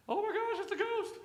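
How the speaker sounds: shrill, high panicked shriek of fear; frightened cry. Acted. Disciples seeing a ghost on the water, high panicked cry. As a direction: squeal it like terrified fishermen.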